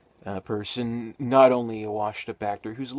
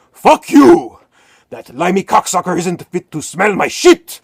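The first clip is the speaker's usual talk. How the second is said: screaming, much louder and higher; an insulted old actor's screaming fit; raging tantrum; 9 semitones higher, 17 dB louder than usual. Retold or imitated. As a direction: explode in a foul-mouthed fit of rage. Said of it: "going off on this horrible tantrum".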